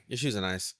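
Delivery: low, soft compliment with no inflection; deadpan it, no smile; flat monotone compliment. Retold or imitated. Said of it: "no inflection on the voice".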